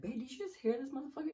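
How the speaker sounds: put-on turning to the boyfriend in fast high disbelief; incredulous to boyfriend. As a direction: rattle it off high, stunned, to your man. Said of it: "So I'm turning around like".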